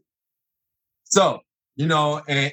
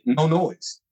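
ordinary talk

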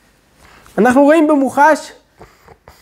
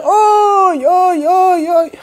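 high, drawn-out cries of oy; old man's pained oy; 6 semitones higher, much slower than usual. Retold or imitated. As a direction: voice the old man's sudden cry of loss. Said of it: "פתאום הוא אומר".

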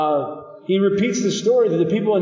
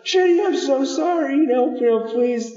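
high-pitched pleading, gradually dropping; pleading apology; 8 semitones higher than usual. Retold or imitated. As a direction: imitate a panicked sibling begging forgiveness. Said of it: "I would try in the middle of this and be like".

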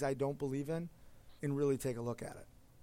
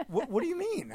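shocked question; 4 dB louder than usual. Retold or imitated. Called a liar at dinner.

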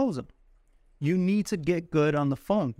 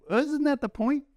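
surprised; 10 semitones higher than usual. Retold or imitated. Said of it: "you're like".

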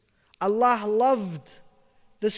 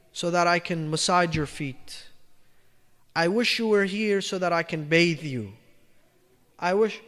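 tender longing; 7 semitones lower, 5 dB quieter than usual. Acted. Shepherd speaking lovingly to God.

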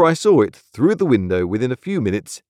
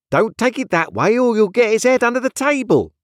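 high-pitched, urgent warning; busy nurse, voice pushed up high; bossy nurse; 14 semitones higher, 5 dB louder than usual. A narrator's reading. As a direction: scold the mistress like an alarmed nursemaid. Nurse warns about baby's head.

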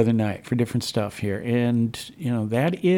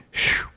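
breathy mock sigh of relief; imitated relieved sigh. Host imitating Priscilla once the show was on track, sigh of relief. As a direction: blow out a relieved "phew". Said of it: "breathed a sigh of relief".

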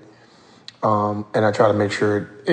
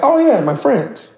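high, slow, beaming "my friends"; cheerful friendliness; 12 semitones higher, 3 dB louder than usual. Retold or imitated. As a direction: greet them like old pals.

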